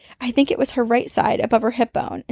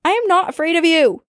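higher, louder defiance; defiant declaration; 7 semitones higher, 3 dB louder than usual. Retold or imitated. To the possessed friend's face, raised defiance. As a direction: refuse to be scared. Defying the demon.

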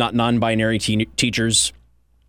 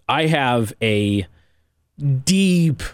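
mock ominous slowdown; much slower than usual. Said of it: "slowed dramatic reveal".

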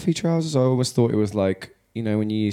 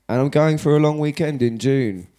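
higher, louder, hammed-up Canadian lilt; mock Canadian accent; 6 semitones higher, 4 dB louder than usual. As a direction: put on a playful Canadian accent. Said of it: "why I'm even doing that accent".

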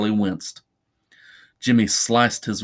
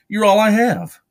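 high, loud desperate plea; desperate pleading; 10 semitones higher, 6 dB louder than usual. Acted. Charlie on his knees to Jimmy, loudly pleading. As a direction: plead it at the top of your register.

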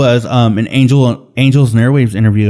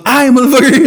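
much higher, hammed-up exasperation; exasperated mock correction; 13 semitones higher than usual. Producer correcting a singer over and over, mock exasperated. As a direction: imitate a producer losing patience, pitched way up. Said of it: "and the guy goes".

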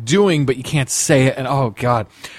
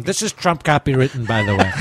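Trump voice. Pretend. Trump impression continued.

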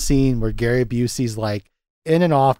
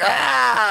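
crazy yell; 10 semitones higher than usual. Retold or imitated. Retelling a pool dive with a crazy mock yell. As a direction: cry out like a madman diving in. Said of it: "does this crazy ass yell".

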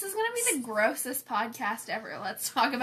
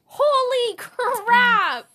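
high, loud, slow shocked cry; 12 semitones higher, 11 dB louder, much slower than usual. Real, not acted. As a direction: stretch it out in disbelief. Shocked at the hairbrush.

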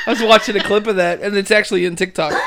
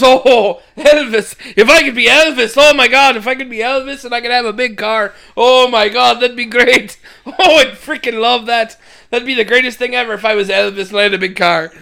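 higher, loud, gushing excitement; excited Dougal; 5 semitones higher, 5 dB louder than usual. Retold or imitated. Dougal dreaming of a big car, gushing with excitement. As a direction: gush over it like a thrilled simple priest.

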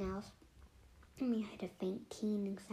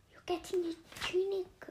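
scolding voice; 9 semitones higher, 5 dB louder than usual. Acted. Scolding dirty friend.

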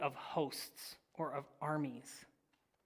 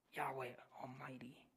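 unvoiced awed whisper; hushed whisper; 4 semitones lower, 15 dB quieter than usual. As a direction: let the name fall to a whisper. Closing the vision, hushed.

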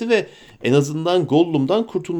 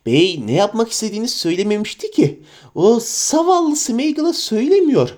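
much higher eager creature voice; Gollum voice; 8 semitones higher, 3 dB louder than usual. Acted. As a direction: wheedle and whine at your master. Gollum keeps persuading.

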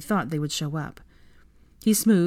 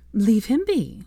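higher-pitched mild scolding; 6 semitones higher, 4 dB louder, slower than usual. A narrator's reading. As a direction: scold him in a raised pitch. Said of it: "Hermione scolded at Theo".

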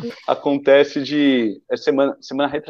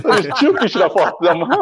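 laughing; 4 semitones higher than usual. Real, not acted. Joking about showing mom.

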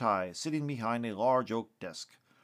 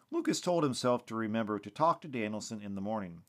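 ordinary delivery, nothing marked

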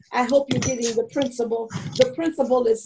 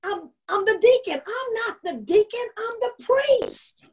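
put-on higher child voice, indignant; little boy voice; 8 semitones higher than usual. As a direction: play the little boy proving himself. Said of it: "And he says".

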